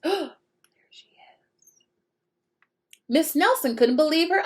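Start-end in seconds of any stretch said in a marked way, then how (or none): 0.0-1.8 gasp, then a hushed whisper. Real, not acted. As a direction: breathe it like a secret. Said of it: "gasp then whisper to listeners".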